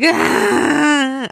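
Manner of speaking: high, loud mock wail; imitated baby cry. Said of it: "crying, like".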